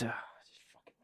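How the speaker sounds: barely voiced, exhausted mutter; despairing whisper. Real, not acted. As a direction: whisper it as if words have run out. Runs out of words in despair.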